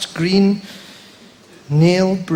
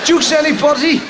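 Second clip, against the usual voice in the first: mock vendor call; 8 semitones higher than usual. Hawking imaginary juice.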